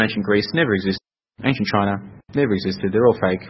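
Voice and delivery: usual speaking voice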